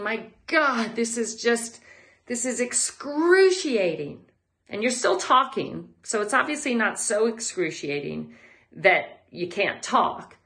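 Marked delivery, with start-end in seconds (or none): 0.0-4.2 higher, drawn-out complaint of pain; mock agony. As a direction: moan it like the workout is killing you. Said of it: "and you're thinking".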